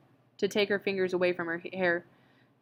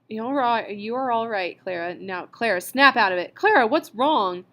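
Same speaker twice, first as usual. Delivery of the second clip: from soothing up to a loud sharp command; urging, growing loud; 3 semitones higher, 7 dB louder than usual. A narrator's reading. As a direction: shake her out of it, louder and louder. Mildred scolding crying friend.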